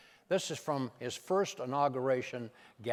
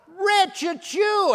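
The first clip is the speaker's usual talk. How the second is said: much higher, loud gleeful cry of discovery; Nancy's triumphant shout; 11 dB louder, much slower than usual. Retold or imitated. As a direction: yell his name like you've caught him out. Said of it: "and she goes".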